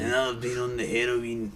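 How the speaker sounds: slow Glasgow character voice; lightly voiced Glasgow addict; Glasgow accent. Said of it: "You're better at it than me".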